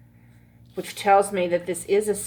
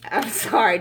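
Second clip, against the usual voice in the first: usual speaking voice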